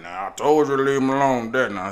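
a touch of a stern dad; stern father warns the boy; scolding father. Retold or imitated.

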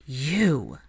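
understated, stunned accusation; a hushed touch of outrage; shocked angry whisper. A narrator's reading. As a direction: breathe it out in shocked anger. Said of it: "breathed out in a shocked and angry whisper".

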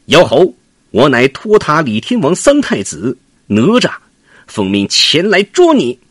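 high, loud declaration; young prince shouting his name at the monkey; shouted challenge. Acted. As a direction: call out your title proudly. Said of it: "哪吒喊道".

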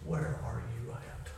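hushed, low, slow plea to God; a quiet broken cry upward; soft crying out. Pretend. Preacher voices a lost soul crying to God, hushed and low. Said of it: "like crying out, saying".

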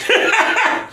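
squeaky laugh; high burst of laughter. Real, not acted. Reacting to the joke.

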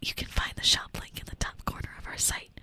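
whispered sponsor read; ASMR ad read. Pretend. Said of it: "live read in A S M R".

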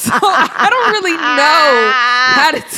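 talking through laughter; laughing. Real, not acted. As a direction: laugh while saying it. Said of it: "your accent is immediately aggressive".

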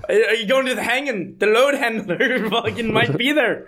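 a hint of Irish, breaking into laughter; mock old Irish accent. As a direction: tease the name in a half-hearted old Irish accent. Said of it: "That was Irish".

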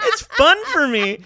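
ordinary delivery

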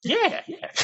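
squeaky high mock agreement; high mock yeah. Mocking his dad's advice, high squeaky yeah yeah. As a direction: pitch way up on the yeahs, mocking. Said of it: "I think I'm like".

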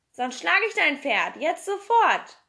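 loud threat rising high; loud, angry villain's ultimatum; threatening demand. A narrator's reading. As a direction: hammer out the ultimatum, stretching the final words.

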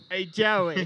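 mimicked henchman.

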